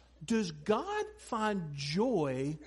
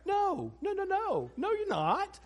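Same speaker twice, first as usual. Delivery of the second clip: much higher, alarmed dad saying no; acted parental refusal; 10 semitones higher than usual. Dad stopping daughter cutting doll's hair, high alarmed refusal. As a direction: forbid it in a high, protesting voice. Dad acts out forbidding scissors.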